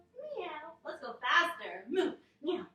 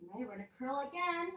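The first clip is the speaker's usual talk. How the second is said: ordinary talk, nothing marked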